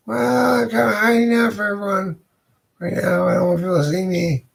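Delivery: woman's voice. Acted. The lady at the door teases Fabian in a high voice.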